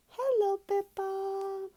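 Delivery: high, bright-toned fairy hello; a fairy greeting a sleepy girl; tooth fairy greeting. Acted. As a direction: greet the child like a magical little fairy. Tooth fairy voiced in story.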